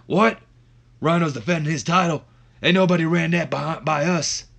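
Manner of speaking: loud, incredulous wrestler impression; high, forceful acted interruption; Stone Cold. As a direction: burst in with a mock-outraged wrestler voice. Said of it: "as Stone Cold and the".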